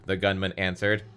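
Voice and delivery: plain reading voice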